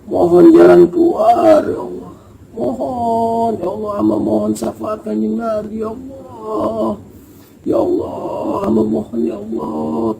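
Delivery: pleading, tearful prayer to God with breaking sobs; crying prayer. Retold or imitated. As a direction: beg through the tears, rising in pitch. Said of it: "gua sambil nangis nih".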